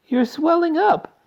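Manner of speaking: high slow loud scream. A narrator's reading. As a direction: scream in horror. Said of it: "screamed Missus Beauregard".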